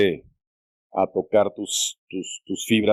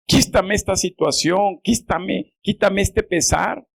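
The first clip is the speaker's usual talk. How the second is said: high, insistent mock petition; acted pleading prayer; 8 semitones higher than usual. Believers asking the Lord to lift sorrow, acted pleading. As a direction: imitate believers begging for relief.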